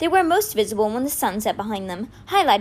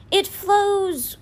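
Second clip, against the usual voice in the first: high, drawn-out guess; slow, uncertain answer pitched high; hesitant; 9 semitones higher, much slower than usual. A narrator's reading. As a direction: stretch each word very slowly, unsure. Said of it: "He hesitated".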